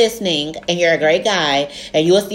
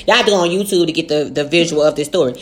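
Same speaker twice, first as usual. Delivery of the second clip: ordinary talk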